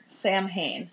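Sam Hain said as two English words, SAM HAYN; "Sam Hain" with a hard m and "hayn"; literal mispronunciation. Shown as a demonstration. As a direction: say Sam Hain just as it is spelled. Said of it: "Not to be confused with the other beer".

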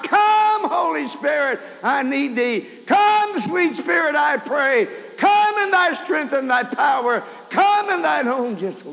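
high, loud shouted hymn chorus; church screaming chorus. Retold or imitated. Preacher recalls the church screaming out the chorus, loud shouted hymn. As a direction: cry the chorus out at full voice. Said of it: "I heard the church screaming out".

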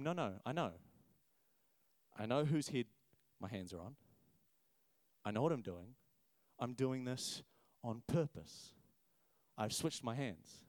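put-on quietly certain old man; calm old father. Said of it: "And Jacob responds to him".

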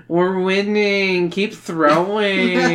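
slow drawling surfer voice; Pauly Shore voice. Acted. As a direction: drag it out, surfer dude. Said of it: "I'm doing Pauly Shore".

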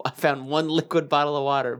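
usual speaking voice

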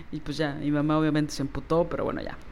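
usual speaking voice

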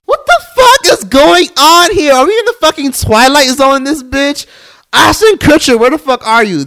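real shocked screaming; shocked outrage. Real, not acted. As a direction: cry out in disbelief. Disbelief at police comparison.

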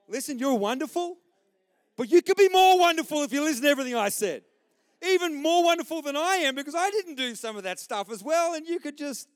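hammed-up dad telling his son he could be better; mock lecturing dad.

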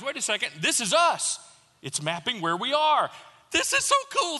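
put-on a kid discovering something cool; retold excitement ending in a high squeal; excited geek. Geeking out over flight map.

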